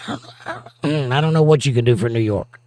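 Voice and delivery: ordinary talk, nothing marked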